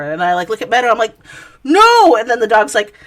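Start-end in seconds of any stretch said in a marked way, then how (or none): 1.6-2.2 loud, high "No!" at the dog; scolding the dog. Retold or imitated. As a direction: bark a sharp no at a naughty dog. Scolding her dog Meadow.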